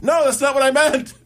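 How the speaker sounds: high-pitched exasperated retort; flustered protest. Retold or imitated. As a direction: blurt out a mock panicked objection. Acted protest of a flustered character.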